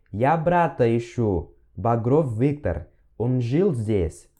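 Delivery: slow, careful Russian repeat. Shown as a demonstration. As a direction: repeat it slowly for students. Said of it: "Ok, mais uma vez". (repetição lenta e didática)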